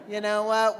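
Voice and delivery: ordinary delivery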